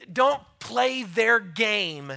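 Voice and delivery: forceful slow warning to the church; loud firm command. Real, not acted. Preacher's strong command.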